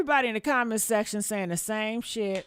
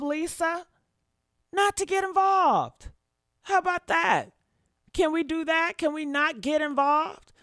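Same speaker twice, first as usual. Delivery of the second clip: exasperated scolding; 5 semitones higher than usual. Real, not acted. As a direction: spell it out to her, fed up. Scolding woman named Lisa.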